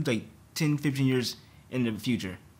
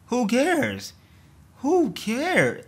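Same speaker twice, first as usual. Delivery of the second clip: high, loud rhetorical demand; loud, high exasperation; exasperated outburst; 10 semitones higher, 5 dB louder, much slower than usual. Real, not acted. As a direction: throw it out in disbelief, pitched way up. Exasperated at trivial TikTok talk.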